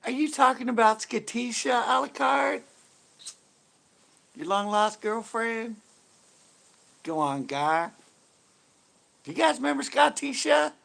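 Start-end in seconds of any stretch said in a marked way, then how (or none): 0.0-5.8 higher, sassy Skatisha voice. Acted. Streamer teasing Alucard as Skatisha, lightly raised. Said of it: "a whole show as Skatisha". 9.2-10.7 higher, sassy Skatisha voice. Acted. Streamer asking chat about Skatisha, lightly raised voice. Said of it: "a whole show as Skatisha".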